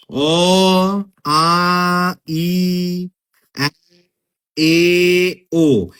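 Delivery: অ, আ, ই, এ, ও each held long and separately; open-mouthed vowels. Shown as a demonstration. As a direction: sustain each vowel অ, আ, ই, এ, ও on a level tone. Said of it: "মুখটা সম্পূর্ণ খোলা থাকে".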